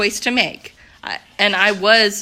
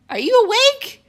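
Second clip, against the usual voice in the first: much higher call, a bit louder; 14 semitones higher, 4 dB louder than usual. Real, not acted. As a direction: call across the room to someone napping. Calling out to someone sleeping.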